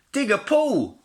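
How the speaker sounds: a spoilt piglet barking an order; bossy piglet. A narrator's reading. Brenda orders Brian about, high and loud.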